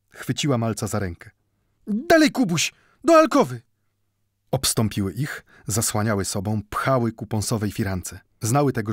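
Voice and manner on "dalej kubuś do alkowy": high, loud, breathless folk voice of a frightened woman; urgent caretaker woman. A narrator's reading. Caretaker woman urging Mendel to hide from the mob, loud and high. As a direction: press him to hide, voice pitched up and loud. Woman hurrying the boy away.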